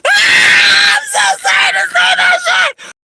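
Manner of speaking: dissolving into high-pitched laughter; cracking up loud at her own joke; laughing. Real, not acted. Laughing at her own joke to viewers, then a loud high laughing apology.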